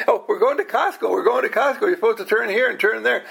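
teasing imitation of Ellen giving directions; imitating fussy Ellen. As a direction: parody a nagging passenger. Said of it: "Ellen gets all of".

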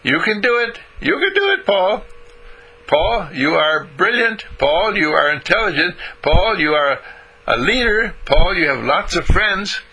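little inner voice. Acted. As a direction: cheer yourself on from inside.

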